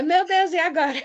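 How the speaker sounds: amused imitation of nervous panic; laughing mock panic. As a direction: act out stage fright through laughter. Laughs acting out microphone nerves.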